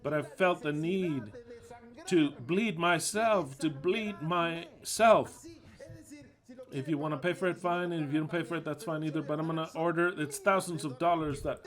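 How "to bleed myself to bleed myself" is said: slow, loud, dragging out to bleed myself; slow emphatic repeat. Real, not acted. Preacher to congregation, slow heavy emphasis. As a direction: lean hard on bleed and myself, stretched slowly.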